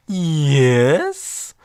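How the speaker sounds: a hint of an uncanny sing-song yes; eerie yes voice. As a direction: give the same strange yes again. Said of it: "Just this voice I'm doing for the yeses".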